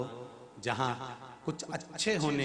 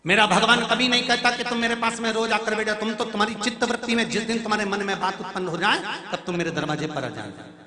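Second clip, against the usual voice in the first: higher, louder and fast devotional sermon; impassioned preaching; 8 semitones higher, 8 dB louder, much faster than usual. Acted. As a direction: pour it out for the Lord, quickly. Preacher rising again, fast.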